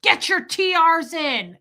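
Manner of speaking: loud, high, slow scolding yell; imitating angry dad. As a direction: spit it out like a furious father. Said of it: "my dad would be like".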